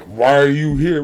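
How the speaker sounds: cold "Why are you here?" with weight on "are"; suspicious challenge. Retold or imitated. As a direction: demand why the stranger came, unwelcoming. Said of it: "We're not like".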